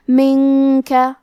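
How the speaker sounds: منکا slowly, with a nasal hum on the nun; hidden nun with ghunna. Shown as a demonstration. Teacher demonstrates the ikhfa, slow chant. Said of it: "غنہ کے ساتھ ادا کریں گے".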